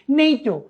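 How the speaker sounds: নেইটো said as a single word, NAY-toh; as one word. Shown as a demonstration. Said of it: "আমি একটি শব্দের মতো পড়ছি". (নেইটো এক শব্দে উচ্চারণ)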